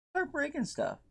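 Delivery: squeaky high-pitched repeat. Pretend.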